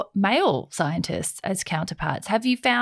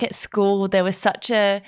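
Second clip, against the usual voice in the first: usual speaking voice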